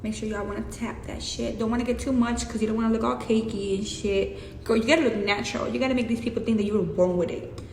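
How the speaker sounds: normal talking voice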